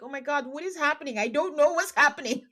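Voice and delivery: overwhelmed viewer blurting out confusion; confused panic. Retold or imitated. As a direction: play it lost and flustered, voice climbing. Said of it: "I'm like".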